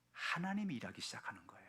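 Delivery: hushed, breathy whisper to the congregation; soft whisper. Real, not acted. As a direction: lean in and whisper it like a secret. Preacher drops to a secret.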